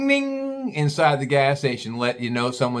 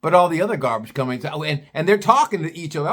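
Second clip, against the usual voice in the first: ordinary talk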